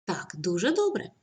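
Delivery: usual speaking voice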